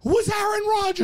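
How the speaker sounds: high-pitched, incredulous punchline; amazed storyteller's climax; astonished reveal. Acted. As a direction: land the reveal high, in disbelief.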